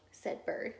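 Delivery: plain reading voice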